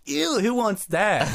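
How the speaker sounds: high, slow mock-disgusted kid voice. The movie kids refusing mayonnaise, high slow disgusted whine. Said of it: "And they're like".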